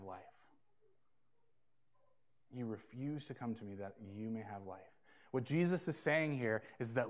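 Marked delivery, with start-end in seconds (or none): 2.5-4.9 soft repeat. Shown as a demonstration. Pastor softly repeats the verse to the congregation, hushed. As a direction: repeat it much softer, leaning on "refuse". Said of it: "look at verse forty with me".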